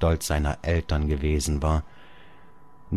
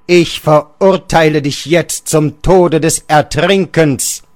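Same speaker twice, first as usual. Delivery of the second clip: much louder, higher verdict, slowing at the end; louder sentence; 5 semitones higher, 8 dB louder than usual. Acted. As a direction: pronounce the death sentence like a judge. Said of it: "Und lauter".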